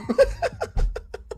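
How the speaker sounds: squeaky high laugh; high giggle. Real, not acted. Laughing at his own stumble, high and squeaky.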